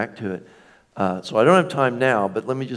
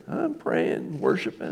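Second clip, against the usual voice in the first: innocent churchgoer; 5 dB quieter, slower than usual. Retold or imitated. Mimicking the churchgoer's innocent reply.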